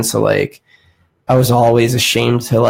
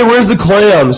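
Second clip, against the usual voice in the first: higher, louder put-on cook voice; goofy cook voice; 9 semitones higher, 4 dB louder, slower than usual. Cooking student in a joke kitchen bit, higher goofy put-on voice. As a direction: bark it like a clueless line cook.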